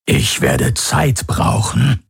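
slow, strange rasping whisper; a slow alien whisper; whispery creature voice. A narrator's reading. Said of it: "seine Flüsterstimme".

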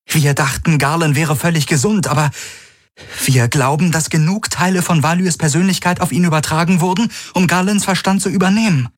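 high, urgent explanation; Kirai explicando angustiada. A narrator's reading. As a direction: hurry to explain, pitch climbing.

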